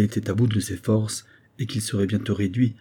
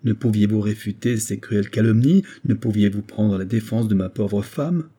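ordinary delivery

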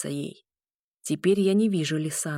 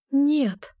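breaking voice; 8 semitones higher, 4 dB louder than usual. A narrator's reading. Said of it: "выдавила я из себя срывающимся голосом".

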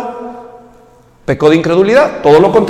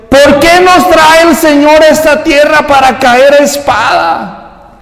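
high, slow mocking complaint; whining Israelites; 6 semitones higher, 3 dB louder, slower than usual. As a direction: whine it like a sulky crowd. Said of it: "Versículo tres". (queja burlona y aguda)

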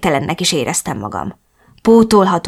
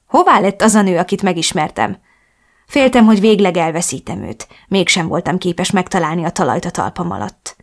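ordinary delivery

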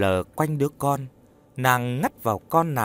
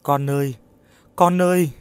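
put-on raised, building wail to her child; tearful calling; 4 semitones higher, 5 dB louder than usual. A narrator's reading. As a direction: call to your child on the verge of tears. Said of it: "mếu máo". (mếu máo gọi con)

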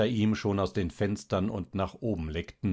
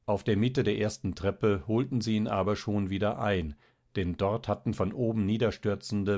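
ordinary delivery

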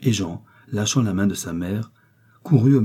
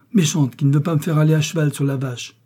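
put-on higher-pitched whining child's protest; tearful little boy; 7 semitones higher, 4 dB louder than usual. A narrator's reading. Boy refused a ride on the cow, whining tearfully. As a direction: wail it lightly like a thwarted child. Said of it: "dit le petit garçon en pleurant".

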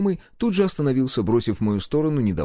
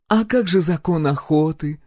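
higher, drawn-out taunt; teasing; 7 semitones higher, 4 dB louder than usual. A narrator's reading. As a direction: needle the hunter slowly and deliberately. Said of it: "словно специально продолжала дразнить охотника". (дразнить нараспев)

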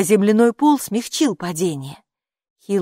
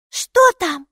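high, loud eager question; high-voiced, inquisitive little character; curious Maika; 12 semitones higher, 7 dB louder than usual. A narrator's reading. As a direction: pipe up with keen interest. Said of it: "заинтересовалась Майки".

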